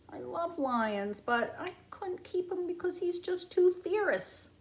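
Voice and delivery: ordinary delivery, nothing marked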